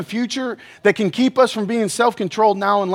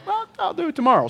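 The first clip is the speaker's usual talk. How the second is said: high, quick put-off voice; procrastinator's excuse; 9 semitones higher, 3 dB quieter, faster than usual. Acted. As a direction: toss it off in a high, hurried voice. Acting out a lazy procrastinator's excuse.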